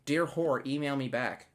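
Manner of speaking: mock polite. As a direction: pretend to be courteous. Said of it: "Sorry, that was disrespectful. What I meant was".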